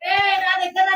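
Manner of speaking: wailing complaint while crying; crying shout. Real, not acted. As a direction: shout it through real sobs. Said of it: "నువ్వు ఎందుకు ఏడుస్తున్నావు?".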